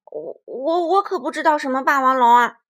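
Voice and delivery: loud stammering denial; startled T-rex hiding who he is; panicked denial. Acted. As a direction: deny it too hard. T-rex denying his identity.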